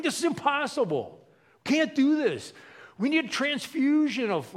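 high, loud, overwhelmed complaint; hammed-up desperate outcry; exasperated protest. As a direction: protest that it can't be done. Acts apostles protesting they can't.

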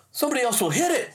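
retold urgent warning; urgent wife. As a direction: voice the wife pressing her point, fast and high. Wife urges action in story.

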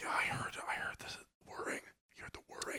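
whispered reply, very soft; hushed whisper. Acted. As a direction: whisper back about a strange noise. Whispered reply about a noise outside.

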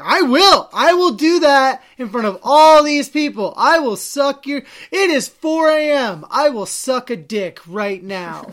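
loud, high, hammed-up outburst; defiant shouting. Retelling a comeback to the seat thief, defiant shout. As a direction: call the bluff at full volume, acting it out.

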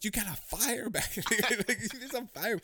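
excited high yell. Retold or imitated. Retelling yelling about fire.

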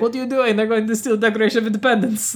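high-pitched, badly faked German accent. Podcaster mocking how Diane Kruger sounds in the movie, bad German accent. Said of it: "That's not a German accent, but that's how she sounds".

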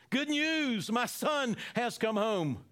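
high, loud cry of joy, slowing at the end; joyful father. Acted. Father announcing his son has come home, overjoyed.

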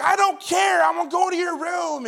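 put-on loud, high defiant yell of a small boy; defiant child shout. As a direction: shout it like a stubborn kid refusing to stay in bed. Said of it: "He's like".

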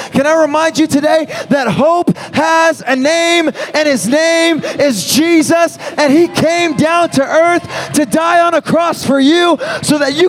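preaching at full force. Real, not acted. Pastor to the room at the altar call, in full preaching voice. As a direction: proclaim it, leaning into every word.

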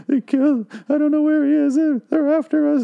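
very high, squeaky melodramatic panic; mock terrified actress. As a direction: imitate a bad horror actress in hysterics. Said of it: "a dumb actress".

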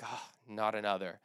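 imitating a weary groan, low-pitched; low, tired mock complaint; exhausted. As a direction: sigh it out as if worn out. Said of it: "out of his exhaustion".

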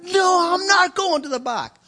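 loud, high furious refusal; indignant shout. Retold or imitated. Pastor yelling at the customs agent, loud and high. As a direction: dig in and yell at the official. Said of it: "soon as I shouted".

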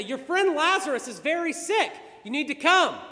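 high, loud plea to come; acted urgent summons; urgent messengers. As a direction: raise the voice in pressing alarm.

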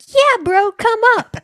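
high, loud excited urging. Retold or imitated. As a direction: call him up to the stage eagerly. Staff urging rapper.

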